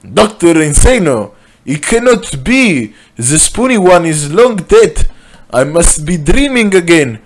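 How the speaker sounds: high, theatrical shock; overacted astonished outburst; hammed-up disbelief. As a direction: cry out as if seeing the dead return. Benzai faces a dead villain.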